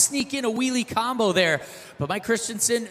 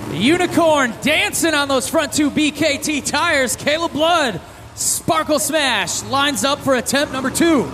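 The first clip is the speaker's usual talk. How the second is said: hype announcer; 5 semitones higher than usual. Real, not acted. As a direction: stretch the truck name out. Hype truck introduction.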